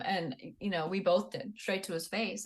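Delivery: ordinary talk, nothing marked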